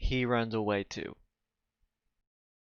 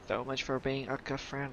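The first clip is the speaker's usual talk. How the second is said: quieter mocking repeat; muttered sarcasm; 7 dB quieter than usual. Real, not acted. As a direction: mutter it under your breath. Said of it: "said twice, second muttered".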